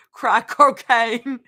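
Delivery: slow, loud, laughing Australian; hammed-up Aussie drawl; bad Australian accent. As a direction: stretch it out in mock Australian. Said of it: "read in a best Australian accent".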